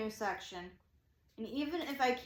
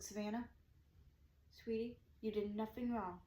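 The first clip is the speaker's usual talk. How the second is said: soft, soothing imitation; tender quote, much softer; retold reassurance; 9 dB quieter than usual. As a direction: comfort a worried driver, voice down. Cop reassures upset speaker, retold.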